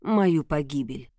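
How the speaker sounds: ordinary delivery, nothing marked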